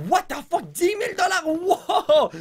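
high squealing laugh at the price; laughing disbelief. Real, not acted. Thief discovers pricey gold bars, squealing with laughter.

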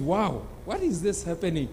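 acted astonishment, kept low; hushed amazement. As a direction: murmur it in quiet disbelief. Stunned Zacchaeus reacts to the miracle.

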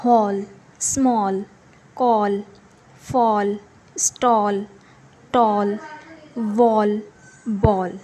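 teacher reading examples to the class; aw word list. Shown as a demonstration. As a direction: read each word with a round aw. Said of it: "इसको ओ का sound देंगे".

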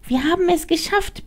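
very high relieved squeak; squeaky rat voice. Acted. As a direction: pipe it like a tiny rat. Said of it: "piepste die Ratte".

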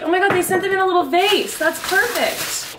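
high, loud, fast delighted gush; excited. Real, not acted. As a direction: gush over a surprise gift. Opens flower delivery.

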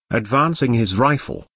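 usual speaking voice

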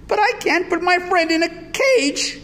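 high-pitched protesting wail; upset child crying out; distressed cry. A narrator's reading. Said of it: "cried Louis".

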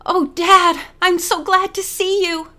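high, loud, delighted greeting; glad exclamation. A narrator's reading. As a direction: exclaim it with joy to a parent. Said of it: "she exclaimed".

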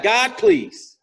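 acted-out plea to God; mock pleading prayer. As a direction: beg God, drawing out the please. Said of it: "I pleaded with the Lord".

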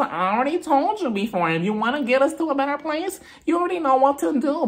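prim, preachy imitation; imitating Meredith. Meredith voiced by recapper.

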